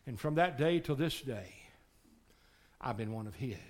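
ordinary talk, nothing marked

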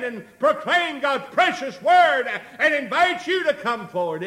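loud, high-pitched pulpit exhortation; full-force preaching. Real, not acted. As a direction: drive each phrase home to the congregation.

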